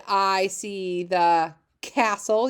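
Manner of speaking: stretched out very slowly; slow read-along. Shown as a demonstration. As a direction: read each word slowly for the class. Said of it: "reading the sentence aloud".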